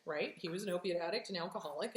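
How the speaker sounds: ordinary talk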